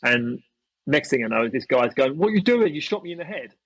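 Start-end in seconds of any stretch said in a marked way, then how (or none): 2.2-3.5 high-pitched aggrieved imitation; mock indignant complaint. Hit player confronting the shooter, mock indignant.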